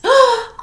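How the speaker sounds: high loud gasp of delight. Acted. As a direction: gasp in amazement. Lucy sees the critter.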